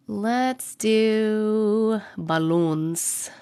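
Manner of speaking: ordinary talk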